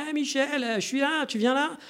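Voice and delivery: high-pitched call across a busy street; imitating someone hollering at a friend in the street; street yelling. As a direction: shout to Michel through the crowd. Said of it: "qui vont hurler en pleine rue".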